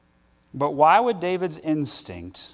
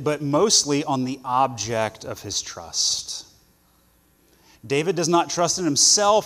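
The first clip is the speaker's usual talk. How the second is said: ordinary talk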